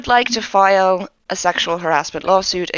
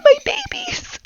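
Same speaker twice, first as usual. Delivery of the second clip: high, unvoiced whisper; breathless whisper. Bitsy trailing off at the end of her cry. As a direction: let it break into a whispered wail. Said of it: "and she's crying".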